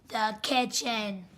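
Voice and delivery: soft sneaky narration; hushed. Pretend. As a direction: narrate like stalking prey. Sneaking up on mom.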